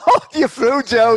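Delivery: high, loud excited shout; delighted cheer. Real, not acted. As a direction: cheer him through to the next round. Quizmaster thrilled at playoff win.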